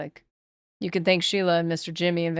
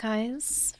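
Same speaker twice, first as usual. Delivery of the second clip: normal talking voice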